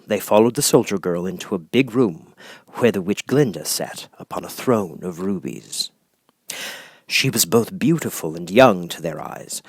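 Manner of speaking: usual speaking voice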